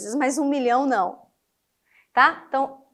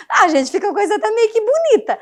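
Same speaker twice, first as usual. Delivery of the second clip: amused; 7 semitones higher, 5 dB louder, faster than usual. Real, not acted. Amused after her example.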